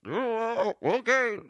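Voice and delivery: high squeaky hurt animal voice; high cartoon dog voice. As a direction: cry up from the well, high and silly.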